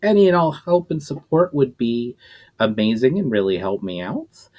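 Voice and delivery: plain reading voice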